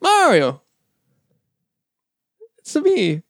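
high-pitched video game hero call; Mario. Acted. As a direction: pitch it up like a cartoon plumber. Mimics the game character Mario.

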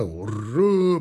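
echoed hurrah from the ranks; soldiers' battle cry. A narrator's reading. Infantry take up the cry, charging hurrah. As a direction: take up the cheer in another voice. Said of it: "подхватили клич пехотинцы".

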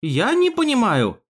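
high-pitched, helpless plea; weak, hesitant denial; mumbling. A narrator's reading. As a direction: feign confusion, uneasily. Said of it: "замямлил Герхард".

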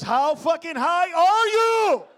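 high, slow, drawn-out mock disbelief; Randy's stoned disbelief. Friend asking the stoned comic, slow and high. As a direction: stretch it out like a stunned friend. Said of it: "And Randy goes".

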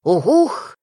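bird cry. Acted. Narrator voices a night bird's signal, hooting. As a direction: hoot it out like a bird.

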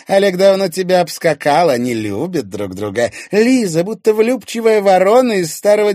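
high, loud scornful woman; taunting Irina. Acted. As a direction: jeer at his hopeless chances.

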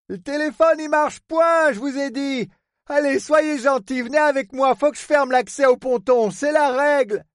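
high, rustic, exasperated urging; insistent harbour superintendent. Acted. Port man must close the pontoon, insistent urging.

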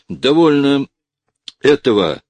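high, very loud outburst. A narrator's reading. Officer has had enough, exclaiming loudly. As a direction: break off, at a loss for words. Officer breaks out, words fail.

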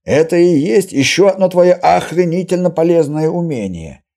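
loud, high-pitched sarcastic scoff; mock her gift as useless; sceptical sneer. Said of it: "скептически хмыкнул Костоправ". (громко, со скепсисом и насмешкой)

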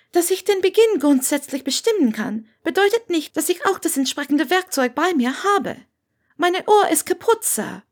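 a polite nervous student; young Hermine. A narrator's reading. Hermine voice, retake.

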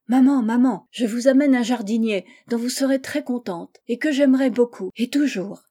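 acted childlike excitement; excited announcement. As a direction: burst in calling for mother, eager. Girl bursts in with good news.

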